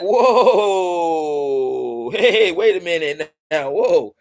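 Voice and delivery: hesitant. Retold or imitated. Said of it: "they hesitated".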